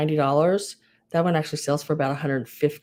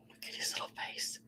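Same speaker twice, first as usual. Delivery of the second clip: overwhelmed by cuteness; 20 dB quieter than usual. Real, not acted. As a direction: whisper it, melting over something cute. Cooing over a cute figurine.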